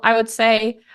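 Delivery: ordinary delivery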